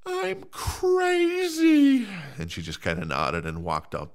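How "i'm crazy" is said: very high, very slow mock-crazy voice; mock crazy reply. Answering the wife, in a very high, slow loopy voice. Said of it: "And I said".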